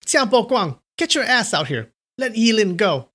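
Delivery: high commanding shout; ringing call. A narrator's reading. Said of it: "Ding Yi's voice rang out".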